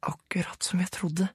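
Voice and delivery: high whisper from the grave. A narrator's reading. Sara whispering from the bottom of the grave. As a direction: hush it like a secret found. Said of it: "sa Sara".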